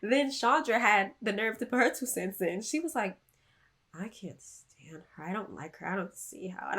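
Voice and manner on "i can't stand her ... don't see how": low, very soft imitation of griping; hushed, slower mock complaint; mimicked grumble. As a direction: imitate someone griping under their breath. Mimics Chandra butting in.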